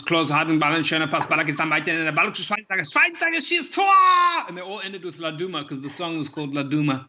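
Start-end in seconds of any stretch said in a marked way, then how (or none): 0.0-3.9 quick German play-by-play rising into a high shouted Tor; German commentary ending in a goal shout; German soccer commentary. Shown as a demonstration. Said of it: "the German one was".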